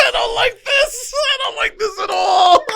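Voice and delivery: loud, high, laughing wail of protest; mock-distressed wail. Real, not acted. Guest protesting the trauma class, high wailing shout. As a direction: whine it out at a shout, half laughing.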